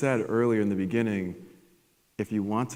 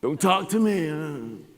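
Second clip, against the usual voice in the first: put-on sulky teen; 7 semitones higher, much slower than usual. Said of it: "You know, I called".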